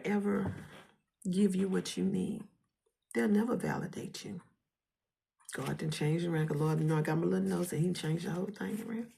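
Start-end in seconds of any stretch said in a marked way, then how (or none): none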